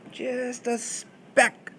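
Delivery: "Just a speck" high and drawn right out; emphasis on SPECK. Shown as a demonstration. Teacher showing emphasis on leaven, high, stretched "speck". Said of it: "Greek emphasizes it by putting that at the beginning".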